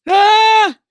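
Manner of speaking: high loud startled ah; startled cry. Acted. Wizard startled by the rat, high cry. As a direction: jump at the noise.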